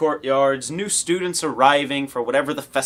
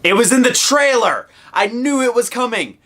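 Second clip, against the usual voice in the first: excited outburst; 10 semitones higher, 6 dB louder than usual. Real, not acted. Excited realization.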